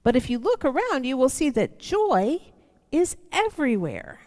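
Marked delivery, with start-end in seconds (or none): none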